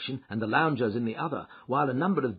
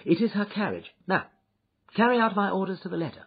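higher, urgent detective voice; brisk detective, commanding; 9 semitones higher, 4 dB louder than usual. A narrator's reading. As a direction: break off and issue brisk orders.

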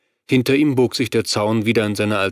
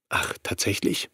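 a breathy, mocking whisper; whispered sneer; 2 semitones lower, 8 dB quieter than usual. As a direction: scoff at him in a whisper. [name] mocks Bill's threat.

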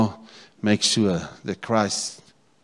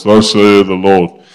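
booming pulpit proclamation, slowed right down; mock deep preacher voice; 14 dB louder, slower than usual. Preacher mocking the pulpit voice to his congregation, booming. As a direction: put on the big platform preacher voice. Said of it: "get a deep voice".

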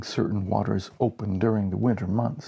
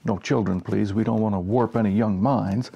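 plain reading voice

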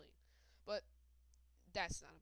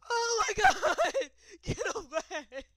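laughing scream; 11 semitones higher, 18 dB louder, slower than usual. Real, not acted.

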